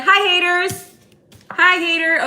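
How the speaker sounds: high, loud sing-song wave-off; breezy taunting hello to haters; sassy greeting. Shown as a demonstration. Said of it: "Just be like".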